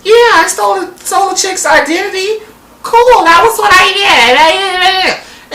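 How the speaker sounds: chirpy mocking impression; mock happy, upbeat. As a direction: brag cheerfully in a put-on high voice. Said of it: "she's happy and she's upbeat".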